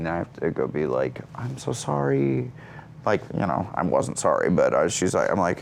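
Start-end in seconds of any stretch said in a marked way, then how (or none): 1.3-2.5 high, slow mock contrition; insincere apology. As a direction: draw out a put-on sorry to a crying mom. Son consoles crying mother.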